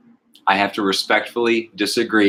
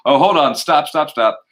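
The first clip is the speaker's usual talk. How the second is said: higher, louder imitation of calling a halt; Frank; 6 semitones higher, 4 dB louder than usual. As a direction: act out breaking off mid-take. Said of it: "he tries to keep going".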